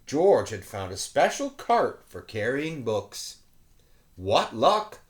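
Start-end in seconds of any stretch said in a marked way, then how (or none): none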